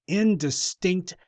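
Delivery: normal talking voice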